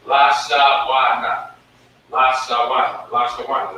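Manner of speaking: Lashawana spelled out slowly, syllable by syllable; teacher pronouncing the word; sounded out slowly. Shown as a demonstration. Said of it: "that's how you pronounce it".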